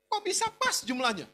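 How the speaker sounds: mock-tearful questions climbing high at the end; retold tearful disbelief. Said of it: "Dia nangis, dia bilang gini".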